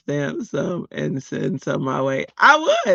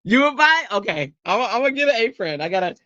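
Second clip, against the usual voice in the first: ordinary talk, nothing marked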